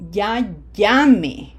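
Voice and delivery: "Ya llame" with a loud first syllable in "llame"; stress off the E. Shown as a demonstration. Teacher showing the word without accent, first-syllable stress on "llame". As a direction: punch the "lla" of "llame", let the final e drop. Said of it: "emphasis is not on the E".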